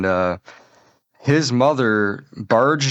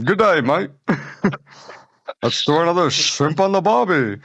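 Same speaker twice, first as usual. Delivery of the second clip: higher mock Aussie voice; laughing Australian impression; Australian mode; 7 semitones higher than usual. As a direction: ham up the Aussie bloke. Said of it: "goes into the Australian mode".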